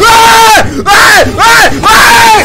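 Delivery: loud high shrieks of ay; panicked yelps. Real, not acted. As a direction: shriek in fright, again and again. Caught by the monster, screaming. (gritos agudos de susto)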